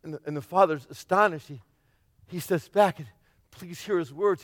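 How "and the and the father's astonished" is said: usual speaking voice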